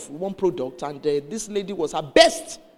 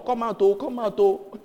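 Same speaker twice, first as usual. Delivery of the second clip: higher, mildly raised call; retold urgent calling; 3 semitones higher, 3 dB louder than usual. Calling pastor out of the bathroom, urgent retold call. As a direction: re-enact calling someone out urgently. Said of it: "I went to bang on the door".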